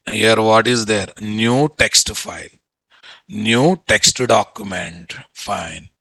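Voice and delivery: ordinary talk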